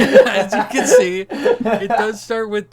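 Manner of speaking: loud, high, talking through real laughter; laughing. Real, not acted. Host presenting joke timeline to co-host, laughing. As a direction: push on through the giggles, loudly.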